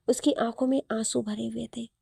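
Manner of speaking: usual speaking voice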